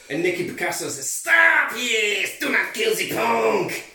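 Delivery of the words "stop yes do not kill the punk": hammed-up Mexican cartoon accent, high and shouted; Speedy Gonzales accent. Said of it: "You sound like fucking Speedy Gonzales".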